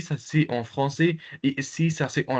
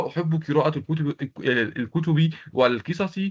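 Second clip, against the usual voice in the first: fast run-through; 3 semitones higher, much faster than usual. Shown as a demonstration. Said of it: "c'est-à-dire".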